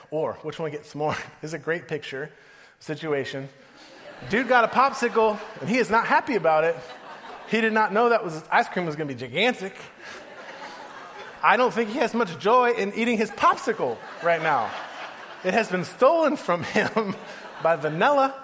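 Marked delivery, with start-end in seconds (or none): 4.3-6.8 excited. Real, not acted. Laughing at a picture. 7.5-18.3 talking while laughing at the picture; can't keep a straight face; laughing through it. Real, not acted.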